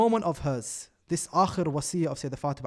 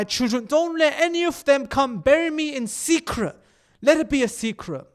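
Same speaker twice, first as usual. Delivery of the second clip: higher, building louder into an urgent plea; impassioned plea; 5 semitones higher, 4 dB louder than usual. Retold or imitated. Dying wife begs Ali to bury her in secret, impassioned plea. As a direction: plead with mounting force, pitch climbing. Preacher voices Fatima's last anguished plea.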